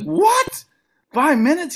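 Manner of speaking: high, yelped retold shock; startled disbelief. New comic told he has five minutes, high startled disbelief. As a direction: blurt it in high-pitched alarm.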